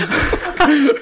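high burst of laughter; laughing. Real, not acted. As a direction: break into loud laughter. Eating on air, laughing.

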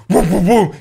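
mock woofing, mouth sounds; lightly voiced woo woo; imitated bark. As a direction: bark it out like a big dog. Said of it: "the dog gives it this".

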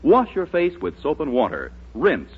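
ordinary talk, nothing marked

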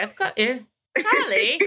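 a lightly voiced English mother-in-law, rising high on "Charlie"; hint of an English mother-in-law; imitating mother-in-law. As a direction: mimic the mother-in-law with an affectionate laugh. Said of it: "my mother-in-law, she would say".

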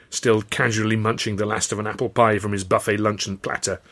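ordinary delivery

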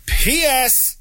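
catchphrase sign-off. Acted.

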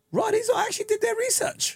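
high, amazed reaction; impressed surprise. Retold or imitated. Viewer reacting to the show's research, high and amazed. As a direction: pitch it up like you can't believe it. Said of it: "and it was like".

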